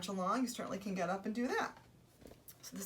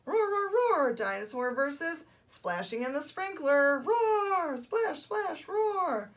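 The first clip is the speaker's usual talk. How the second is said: high-pitched, excited roars; roaring toddler dinosaur; 8 semitones higher, 7 dB louder, slower than usual. Acted. Storyteller stages dinosaur versus sprinkler, high roaring excitement. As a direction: announce the contest, then roar through it.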